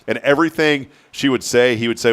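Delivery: ordinary delivery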